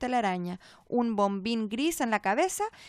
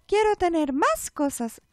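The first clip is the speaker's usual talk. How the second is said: slow, higher doll voice, unnaturally even; too calm creepy doll; 5 semitones higher, 4 dB louder than usual. A narrator's reading. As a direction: repeat it like a broken toy. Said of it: "repitió la muñeca". (muñeca espeluznante y demasiado tranquila)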